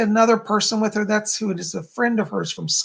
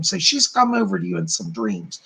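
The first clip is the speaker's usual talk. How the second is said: ordinary talk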